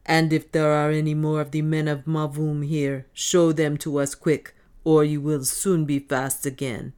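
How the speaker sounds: loud, rough threatening dwarf. Acted. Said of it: "said the dwarf roughly".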